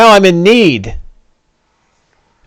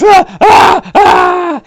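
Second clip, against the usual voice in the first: much higher, shouted ah ah ah; panicked screams; much slower than usual. Acted. Dying NPC burning in radiant flame, panicked screams. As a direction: scream while swatting at swarming butterflies. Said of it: "He goes".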